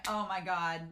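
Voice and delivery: ordinary delivery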